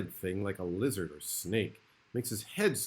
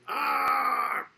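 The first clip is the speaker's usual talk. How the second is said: Phantom's cry; 10 semitones lower than usual. Acted. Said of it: "he goes".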